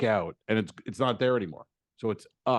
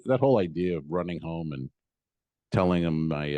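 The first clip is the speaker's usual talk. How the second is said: usual speaking voice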